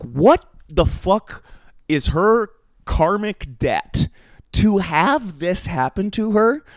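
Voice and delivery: drawn-out, baffled demand; emphatic disbelief. Retold or imitated. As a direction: space it out phrase by phrase, incredulous. Quotes own incredulous reaction, spaced out.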